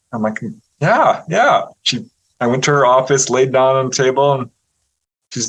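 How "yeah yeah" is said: high, keen acceptance; bright-eyed mock eagerness, pitched up; eager yes. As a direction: retell jumping at an offer. Retold eager acceptance of an offer.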